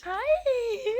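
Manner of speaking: excited greeting. Real, not acted. Greeting puppies at breeder.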